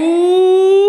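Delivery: loud, high drawn-out ooh; long rising ooh. Real, not acted. Reacting to being tricked.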